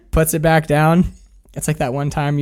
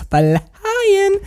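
high and stretched out, drifting; floaty high voice. Real, not acted. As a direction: float the word up, dreamy. Recalling being very high.

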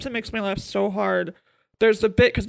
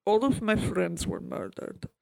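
Emma Thompson; 6 dB quieter than usual. Retold or imitated. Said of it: "Emma Thompson looks at the doctor and goes".

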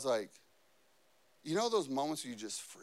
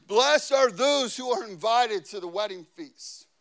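loud, higher ringing announcement; angel's proclamation; 4 semitones higher, 8 dB louder than usual. Acted. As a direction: proclaim the blessing with preacher force.

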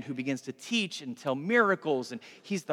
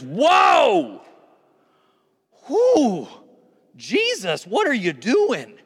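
loud, high, drawn-out whoa; hammed-up astonished outcry; shocked onlookers; 5 semitones higher, 8 dB louder, much slower than usual. Everybody reacts to Jesus rebuking Peter, shocked outcry. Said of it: "I just imagine everybody goes".

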